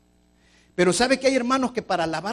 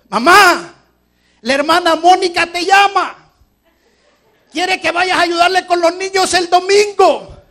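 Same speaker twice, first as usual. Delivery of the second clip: put-on high, loud boy's call; child calling out; 12 semitones higher, 16 dB louder than usual. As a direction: call out to mom from the doorway. Child calls mother in story.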